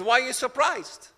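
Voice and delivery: ordinary talk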